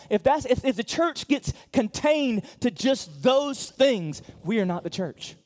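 loud, high, driving preaching; full-force pulpit preaching; fiery preaching. Real, not acted. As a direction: preach it with fire.

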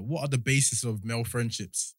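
normal talking voice

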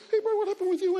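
a mock high friend asking what happened. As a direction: quiz your mate about the girl. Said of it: "He goes".

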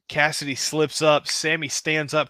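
ordinary delivery

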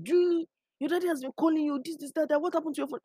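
high-pitched retold urgent questioning; imitating worried friend. Friend relays father's urgent calls.

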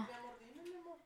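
unvoiced, very soft whisper; whispered mi amor. Showing how she teases her partner, soft whispered endearment. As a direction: breathe it like a secret endearment. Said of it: "hacerla de pedo al revés".

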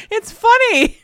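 laughing retort. Retold or imitated. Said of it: "I'm like".

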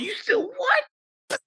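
slow, very high-pitched disbelief; mock shock. Boyfriend hearing she still does it, mock shock. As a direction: stretch it into a high incredulous squeal. Retold shock at girlfriend's answer.

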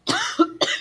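sharp choking cough; coughing fit. Real, not acted. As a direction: cough hard, clearing the throat. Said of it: "tô até tossindo".

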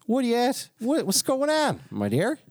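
What are you at and What's going on pitched very high. Shown as a demonstration. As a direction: overdo the high singsong greeting. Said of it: "No, I didn't say it like that either".

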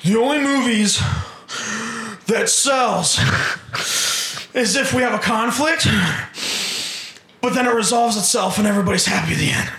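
high, put-on producer voice in broken phrases; mock a pompous studio boss, pausing between phrases; fat executive producer. Hollywood executive producers explain what sells, mocking caricature.

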